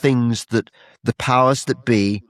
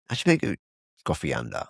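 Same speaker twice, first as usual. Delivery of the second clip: "Coffee Ander" much lower and softer, with a mock West African lilt; West African accent; 8 semitones lower, 8 dB quieter, faster than usual. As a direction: drop low and soft for a joking West African "Coffee Ander". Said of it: "that was my West African accent".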